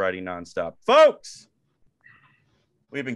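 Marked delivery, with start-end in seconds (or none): 0.8-1.5 announcer call. Real, not acted. Host calling out to listeners.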